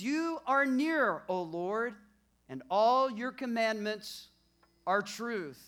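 slow, loud preacher reading; preaching the verse. Acted. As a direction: proclaim the verse to the church. Preaching scripture with force.

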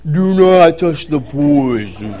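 drawn-out slow-mo command, laughing through it; slow-motion warning. Retold or imitated. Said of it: "it's like slow-mo".

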